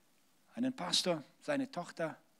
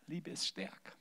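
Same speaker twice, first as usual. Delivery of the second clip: much softer echo of the refrain; a softer, intimate repeat of the refrain; quiet; 10 dB quieter than usual. Shown as a demonstration. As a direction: let "Liebe ist stärker" fall to a soft echo.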